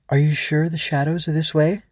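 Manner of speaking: a hint of an uneasy little girl; unsure child. Ava asks the Growbug the way, uneasy.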